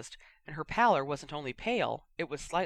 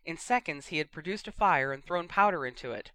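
ordinary delivery, nothing marked

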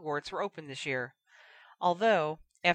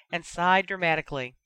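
plain reading voice

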